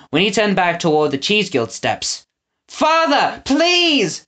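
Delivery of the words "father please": high desperate scream. A narrator's reading. Winnie pleading with her father, desperate scream. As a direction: cry across the square to him. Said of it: "she screamed".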